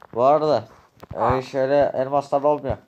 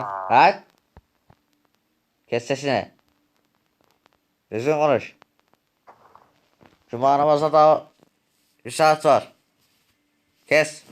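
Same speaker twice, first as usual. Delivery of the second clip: louder, raised voice; angry raised rebuke; angry shout; 2 semitones higher, 5 dB louder than usual. Acted. Quarrel continues.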